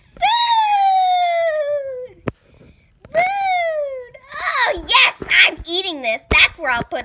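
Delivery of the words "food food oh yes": excited shout. Acted. Excited about food.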